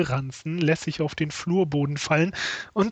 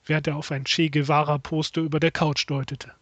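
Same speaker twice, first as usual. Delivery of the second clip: ordinary delivery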